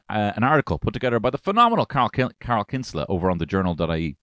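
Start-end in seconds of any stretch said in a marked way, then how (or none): none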